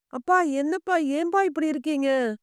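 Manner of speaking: usual speaking voice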